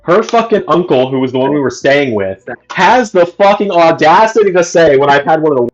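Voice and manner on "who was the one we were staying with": ordinary talk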